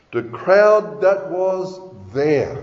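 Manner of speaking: "The crowd that was there" slow, with weighty pauses; slow, deliberate stress on "crowd" and "there"; slow emphatic. Real, not acted. Preacher stresses a key phrase, then repeats.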